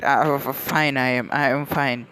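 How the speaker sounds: plain reading voice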